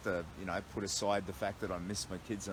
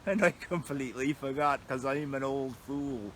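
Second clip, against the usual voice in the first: slow, hammed-up old pirate; apologetic mock pirate; 3 semitones higher, 6 dB louder than usual. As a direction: ham it up as a tired old sea dog. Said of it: "I'm meant to be talking like a pirate".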